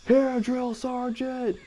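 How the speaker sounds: high, drawn-out recruit's call; recruit answering. Retold or imitated. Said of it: "I'm like".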